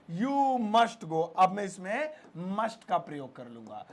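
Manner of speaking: normal talking voice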